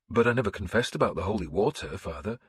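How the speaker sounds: plain reading voice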